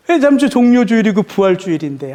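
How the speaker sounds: usual speaking voice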